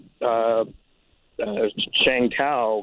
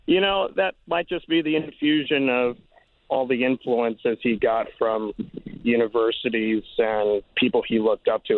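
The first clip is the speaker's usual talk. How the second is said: normal talking voice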